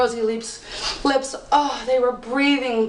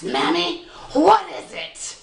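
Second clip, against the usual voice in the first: fairy voice; 7 semitones higher, slower than usual. Acted. Fairy voiced in folk tale.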